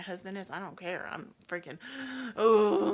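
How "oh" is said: loud, long held moan; labour moan. Retold or imitated. Labouring on the bed, loud held moan.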